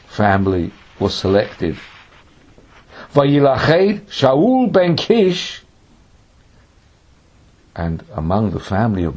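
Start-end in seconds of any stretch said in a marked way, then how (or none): 3.1-5.6 high, loud, slowly drawn-out proclamation; announced result. Acted. Teacher announcing the lottery's winner from scripture, proclaimed. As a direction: call out the chosen name with ceremony.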